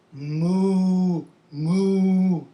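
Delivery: long, level moos; cow moo. Shown as a demonstration. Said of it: "talk about the sounds".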